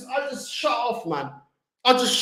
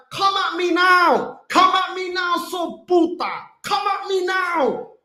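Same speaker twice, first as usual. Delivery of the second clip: loud, slow, higher shouted dare; real angry challenge; 6 semitones higher, 4 dB louder than usual. Real, not acted. Angry fan shouts a dare at chat critics, loud and slow. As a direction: dare the chat to come at him.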